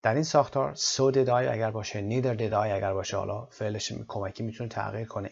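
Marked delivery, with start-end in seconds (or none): none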